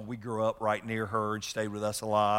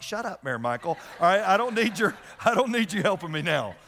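higher and louder comic snap; mock exasperated outburst; 7 semitones higher, 3 dB louder than usual. Dad jokingly blurts at daughter Mary Michael, loud mock annoyance. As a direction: play up being caught out by a child.

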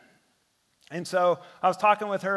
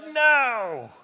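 higher, louder mock cry of dismay; frustrated no; 7 semitones higher, 6 dB louder than usual. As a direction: wail it as the nap gets ruined. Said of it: "You can't hide your disappointment".

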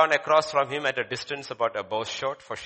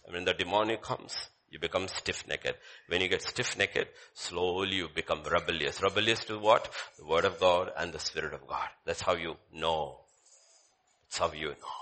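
much softer, low sermon voice; quiet preaching; 10 semitones lower, 7 dB quieter than usual. Real, not acted. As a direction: drop it down, soft and lower.

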